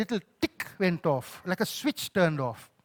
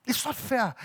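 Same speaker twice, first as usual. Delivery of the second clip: high, drawn-out grievance; aggrieved lament; protesting complaint to God; 5 semitones higher, 5 dB louder, slower than usual. Retold or imitated. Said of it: "have felt in this season".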